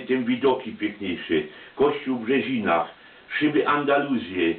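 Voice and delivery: plain reading voice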